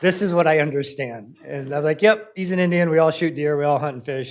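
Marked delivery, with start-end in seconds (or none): 2.0-4.3 louder and higher, upbeat and affirming; hearty agreement. Retold or imitated. Speaker affirming the girl to the class, loud hearty agreement. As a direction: cheerfully back the kid up. Answering the girl's deer story.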